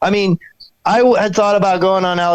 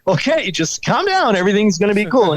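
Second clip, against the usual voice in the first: TV echo of reassurance; 5 semitones higher than usual. Retold or imitated. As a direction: echo the calming words in a high TV voice. TV echoes his reassurance.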